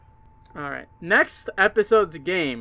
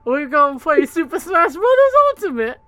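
high silly voice; 10 semitones higher, 6 dB louder than usual. Acted.

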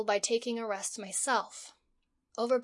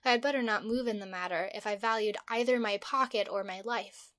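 ordinary delivery, nothing marked

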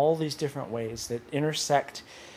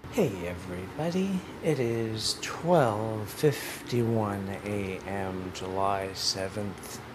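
stoned; 4 semitones lower, 3 dB quieter, slower than usual. Real, not acted. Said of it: "I'm high right now".

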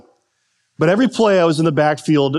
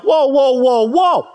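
high, louder whoa, whoa, whoa, whoa; protesting coach; 10 semitones higher, 3 dB louder, slower than usual. Retold or imitated. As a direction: jump in to stop him like an alarmed coach. Line coach stepping in.

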